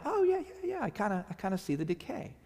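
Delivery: a high "oh" that fades into half-convinced mumbling; unsure agreement. Retold or imitated. Nodding along to Dale's explanation, unconvinced and fading. Pretending to understand the painting.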